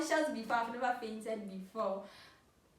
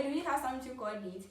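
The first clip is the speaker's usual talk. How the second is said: usual speaking voice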